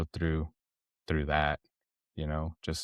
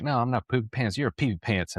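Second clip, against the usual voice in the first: higher-pitched imitation of a sassy daughter; retold cheeky retort; 6 semitones higher than usual. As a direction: lightly mimic a kid talking back.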